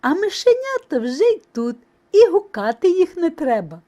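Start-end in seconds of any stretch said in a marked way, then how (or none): none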